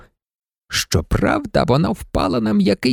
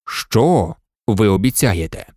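much lower, slow Indian voice; slow deep brave; 9 semitones lower, slower than usual. Acted. Indian asks the maid, low and slow. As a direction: ask it slowly and gravely.

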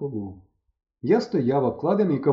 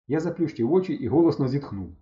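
ordinary delivery

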